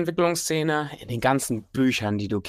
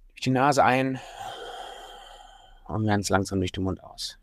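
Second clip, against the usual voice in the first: sultry narrator. Acted. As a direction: talk them calmly through each breath. Leads a breathing exercise.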